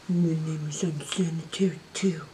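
low soft silly pet voice. Pretend. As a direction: voice the second pet low and quiet. Voicing other pet, low.

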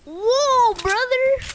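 high, loud startled yell; jolted by a sudden enemy; whoa, loud and high; shocked shout. Real, not acted. Sudden shock during gunfight.

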